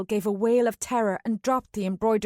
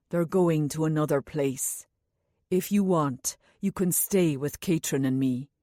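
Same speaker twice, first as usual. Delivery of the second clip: gentle Anulan; 5 semitones lower than usual. A narrator's reading. Anulan asks the child for help, low and gentle. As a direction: reassure the little one.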